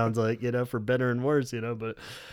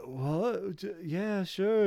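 a lightly voiced, softer, higher Robert caught off guard; imitating Robert; 7 semitones higher, 5 dB quieter, much slower than usual. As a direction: mumble a confused yes as Robert. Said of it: "only do Robert".

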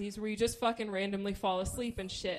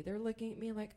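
ordinary talk, nothing marked

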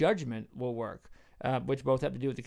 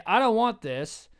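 higher, slow, emphatic rejection; defiant refusal; 6 semitones higher, 4 dB louder than usual. Acted. Sinner refusing the grace of God, defiant. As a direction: push it away with mock indignation.

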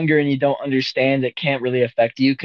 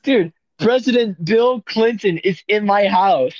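high, slow, drawn-out mock awe; awestruck amazement; 6 semitones higher, slower than usual. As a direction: announce an unbelievable guest, word by word. Acted awe at Clinton's visit.

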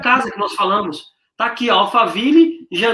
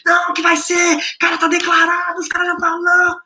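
higher, eager wife voice imitated; excited wife; 7 semitones higher than usual. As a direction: mimic her wide-eyed rush of excitement. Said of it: "insistent wife".

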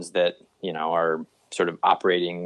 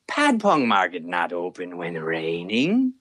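slow, broken-English with a low drop; drawn-out excuse; maitre d' voice; 4 semitones lower, slower than usual. Retold or imitated. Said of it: "the maitre d' is going".

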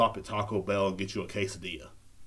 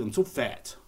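ordinary delivery

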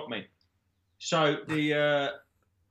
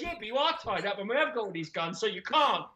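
laughing; 7 semitones higher than usual. Retold or imitated. Captor voiced, still laughing.

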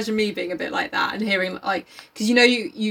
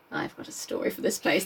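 whispered scheming aside; 5 semitones lower, 8 dB quieter than usual. Retold or imitated. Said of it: "looking around like".

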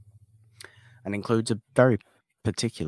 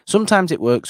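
excited; 12 semitones higher, 11 dB louder than usual. Acted. Contrasting works vs doesn't.

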